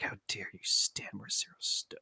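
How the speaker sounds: low, hushed, indignant whisper. Retold or imitated. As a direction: whisper it like a betrayal. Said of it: "and I'm like".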